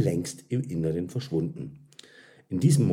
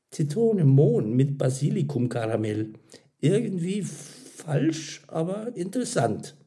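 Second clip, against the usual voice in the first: higher voice, hesitating while tasting; pondering verdict; 9 semitones higher than usual. A narrator's reading. Karin tastes and judges the flavour.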